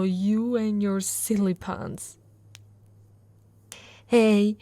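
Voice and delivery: normal talking voice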